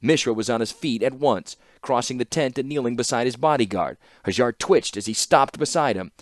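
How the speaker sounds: plain reading voice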